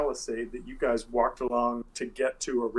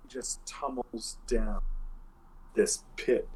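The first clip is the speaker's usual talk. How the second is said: ordinary delivery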